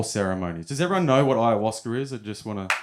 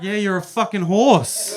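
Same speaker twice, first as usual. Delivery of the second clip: higher, louder, slow, sarcastic reply; deadpan retort; 10 semitones higher, 6 dB louder than usual. Retold or imitated. Father answers the horse son, slow, loud and sarcastic. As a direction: spell out the obvious, dripping sarcasm.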